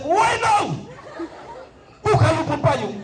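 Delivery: high shouted warning from the commander; komandan teriak. Acted. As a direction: yell the instruction high to someone falling. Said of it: "Lalu komandannya teriak". (teriakan komandan, nada tinggi)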